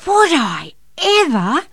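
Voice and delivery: high, loud, drawn-out thrill; excited child. A narrator's reading. Boy accepts a sleigh ride, thrilled. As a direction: exclaim slowly with a child's delight. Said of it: "jumped out of bed in excitement".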